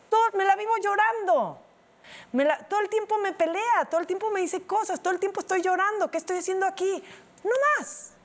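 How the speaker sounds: high-pitched fed-up lament; distressed realization. Retold or imitated. Woman realizing she lives crying, high exasperated lament. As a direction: pile up complaints high, peaking on the last words. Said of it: "desperté y fue así de".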